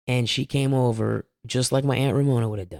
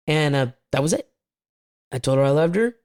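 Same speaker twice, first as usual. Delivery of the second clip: ordinary talk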